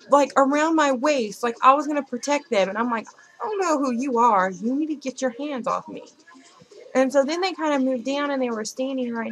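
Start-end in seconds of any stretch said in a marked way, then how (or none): 3.4-6.0 raised, higher indignant voice; retold sharp rebuke; indignant telling-off. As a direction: warn off a pushy stranger. Retelling telling off a stranger.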